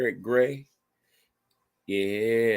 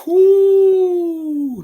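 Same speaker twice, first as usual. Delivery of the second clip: high, loud whoop of disbelief; excited whoo; 10 dB louder than usual. Real, not acted. Fan yelling at the close game on TV, loud high whoo.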